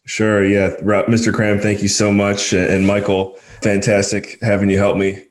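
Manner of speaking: upbeat host sign-off; podcast outro. Acted. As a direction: wrap up the show like a host.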